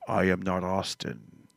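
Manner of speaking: mock-solemn low, slow proclamation; Jesus Christ. Dad voicing son playing Jesus to his parents, solemn.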